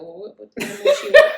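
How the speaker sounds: high, loud, laughing as the puzzled man; laughing confusion. Retold or imitated. Storyteller laughing as she voices the confused man. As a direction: voice his baffled look while cracking up. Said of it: "looking at me like".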